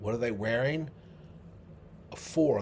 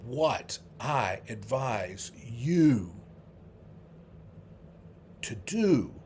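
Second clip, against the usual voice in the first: slow recitation; much slower than usual. Shown as a demonstration. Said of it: "slowly spelling out the quote".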